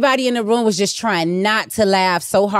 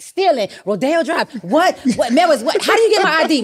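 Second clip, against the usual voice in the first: incredulous outrage; 8 semitones higher than usual. Retold or imitated. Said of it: "I was like".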